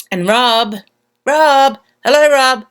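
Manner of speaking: loud, high call across the room. Real, not acted. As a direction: call his name out loud. Calling out to a viewer.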